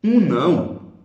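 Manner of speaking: loud, weighted um não; emphatic um não. Shown as a demonstration. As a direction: punch Um não to show it as a noun. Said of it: "Não é um advérbio".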